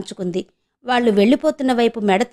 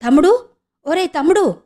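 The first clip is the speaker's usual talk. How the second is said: higher, louder call to a brother; 7 semitones higher, 4 dB louder than usual. Acted. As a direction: call out after him desperately. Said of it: "అరిచింది".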